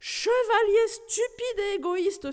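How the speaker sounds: put-on high, slow furious old aunt; fuming. Old aunt scolding her nephew, fuming and slow. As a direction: spit it out in a slow shrill rage. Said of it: "fulmina-t-elle".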